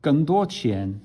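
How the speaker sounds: easy, satisfied Chinese; content Chinese speaker. Shown as a demonstration. Teacher shows the easy Chinese sentence before the struggle. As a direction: say it smoothly like a pleased native. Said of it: "look at the difference".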